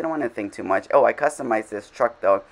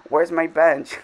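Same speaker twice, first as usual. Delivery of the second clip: higher put-on voice of the neighbour; neighbour's voice; 6 semitones higher, 3 dB louder, slower than usual. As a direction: play the puzzled neighbour asking. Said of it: "she was like".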